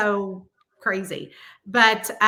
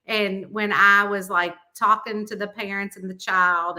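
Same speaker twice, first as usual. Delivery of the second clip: usual speaking voice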